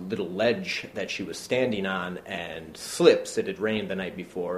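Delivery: ordinary talk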